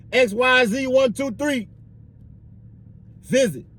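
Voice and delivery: high, drawn-out inmate number call; officer calling out. Acted. Officer calling an inmate for a visit, calling out.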